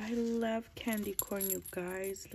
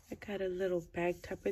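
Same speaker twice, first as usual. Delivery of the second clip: normal talking voice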